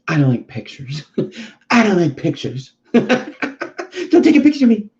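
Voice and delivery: cat's voice climbing high into laughter; voicing the cat, laughing. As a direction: play the camera-shy cat, cracking up. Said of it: "he just like".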